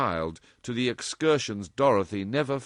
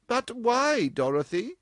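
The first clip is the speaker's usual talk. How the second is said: put-on higher, bewildered older woman; lightly voiced puzzled mother; 3 dB louder than usual. A narrator's reading. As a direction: ask in mild bewilderment.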